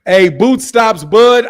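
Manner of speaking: ordinary talk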